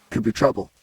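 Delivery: ordinary delivery, nothing marked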